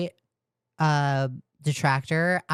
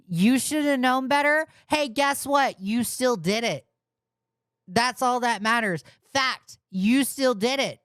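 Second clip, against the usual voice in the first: much higher, sarcastic and exasperated; mock them with rising frustration; exasperated rant; 8 semitones higher than usual. Real, not acted. Streamer ranting at apologizers, high and sarcastic.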